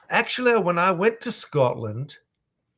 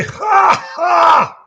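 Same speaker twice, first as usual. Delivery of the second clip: booming big laugh; loud, barking Russian laugh; Heavy's laugh; 11 dB louder than usual. Pretend. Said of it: "could you read this in Heavy's voice".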